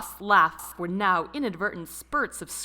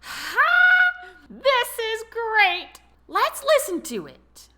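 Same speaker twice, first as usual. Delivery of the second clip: much higher, louder gleeful cackling; high cackle; 9 dB louder, much slower than usual. A narrator's reading. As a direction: crow it out in a high, delighted cackle. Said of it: "let his high cackle go free".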